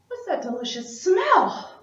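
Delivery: high sniffing dragon, delighted; a greedy dragon catching a scent, voiced up high; hungry dragon asking what smells so good.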